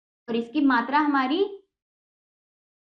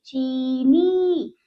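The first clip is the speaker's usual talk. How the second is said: चीनी very slow, both ई held long; drawn-out vowels; 2 semitones lower, much slower than usual. Shown as a demonstration. Teacher reads the word to children, drawn out. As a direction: stretch each syllable of चीनी. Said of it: "हम इसको खींचेंगे".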